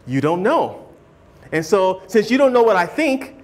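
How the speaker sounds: ordinary talk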